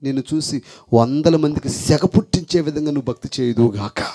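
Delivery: ordinary talk, nothing marked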